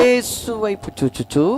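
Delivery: loud, slow, drawn-out scripture call; proclaimed verse. Real, not acted. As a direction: raise the pitch and stretch each word out. Echoes the verse for congregation.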